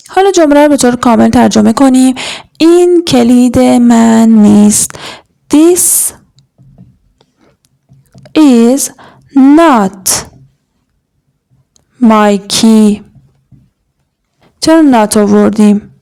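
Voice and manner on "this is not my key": each word set apart by long pauses; slow dictation. Shown as a demonstration. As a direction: dictate "This is not my key" one word at a time. Teacher dictating a translation.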